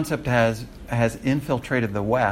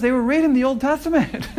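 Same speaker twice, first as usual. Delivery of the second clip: laughing; 14 semitones higher, 3 dB louder than usual. Real, not acted. Laughing at the irony.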